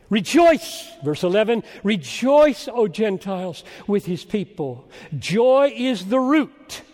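loud, high proclamation with stretched key words; ringing, emphatic sermon fervor; impassioned preaching. Real, not acted. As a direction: proclaim joy from the pulpit, slowing for weight. Preaches at full force.

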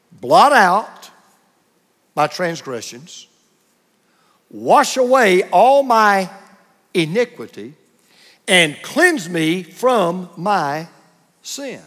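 very slow, long pauses between phrases; slow careful reading. Shown as a demonstration. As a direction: let each plea hang before the next. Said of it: "So I'm gonna read this slowly and carefully".

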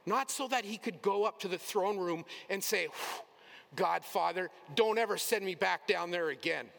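loud, high, exaggerated disgusted plea; mock whiny complaint. Preacher voicing Jesus complaining to the Father, in a loud mocking whine. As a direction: ham up a fed-up Jesus begging not to go back.

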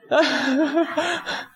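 short real laugh. Real, not acted. Preacher laughing at his own beggar voice. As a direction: laugh at the joke.

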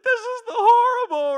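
high, loud hammed-up whine; mock wail. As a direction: whimper at the disaster, hammed up. You're like, wailing.

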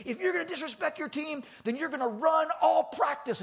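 high-pitched scolding yell, retold; screaming coach. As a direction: dish out punishment as a mock irate coach. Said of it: "keeps screaming at him".

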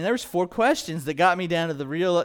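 ordinary delivery

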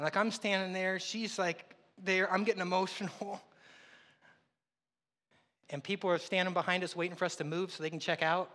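normal talking voice

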